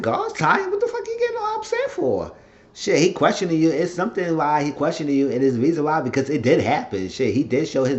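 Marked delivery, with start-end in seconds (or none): 0.0-2.3 much higher, fast squeaky put-on voice; talking back to the TV in a mock voice. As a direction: squeal the retort like a cartoon. Speaker mocks reaction in put-on voice.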